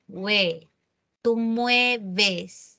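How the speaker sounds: ordinary delivery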